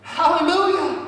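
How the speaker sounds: high, loud, stretched-out preaching; full-force preaching. Acted.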